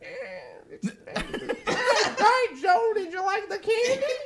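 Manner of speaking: very high, laughing cartoon bunny voice; funny Easter Bunny voice. Grandpa's friend playing the Easter Bunny on the phone, squeaky and laughing. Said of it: "Did he put on a funny voice".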